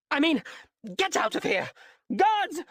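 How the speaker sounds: woman shouting for her guards at an intruder. A narrator's reading. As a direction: yell for help at full pitch. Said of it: "the woman shouted".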